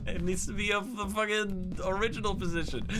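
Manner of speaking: usual speaking voice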